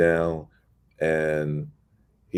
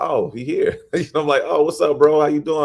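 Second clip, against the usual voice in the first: high, delighted surprise; sudden surprise at a stranger walking in; surprised greeting; 10 semitones higher, 5 dB louder than usual. Retold or imitated. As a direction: caught off guard, greet him warmly. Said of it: "I'm surprised".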